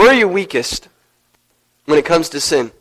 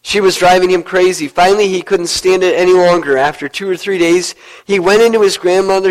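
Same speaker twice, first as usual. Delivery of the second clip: normal talking voice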